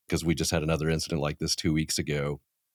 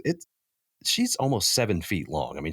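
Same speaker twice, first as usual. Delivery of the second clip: ordinary delivery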